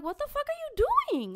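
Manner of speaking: high, loud outraged shout; shocked yell. Retold or imitated. Her retold reply to the creepy man, a high shocked yell. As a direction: yell it in disbelief. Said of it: "I'm like".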